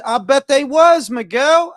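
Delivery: punchy loud "I bet they was"; loud emphatic call. Real, not acted. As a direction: call it out to the room, pumped up. Hyped call-out to Miguel.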